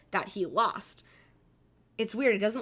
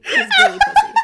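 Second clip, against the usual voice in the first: laughing; 7 semitones higher, 8 dB louder than usual. Real, not acted. Cracking up at own joke.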